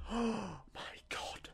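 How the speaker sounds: hushed, slow gasp of wonder; awed whisper. Retold or imitated. Kid seeing the presents spread out, awed whisper. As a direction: breathe it out in slow amazement.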